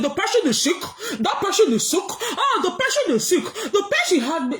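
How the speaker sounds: hammed-up imitation of a friend scolding someone; mocking Lolo. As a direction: shout it like a gossiping brag, cackling. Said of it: "And now she gonna say".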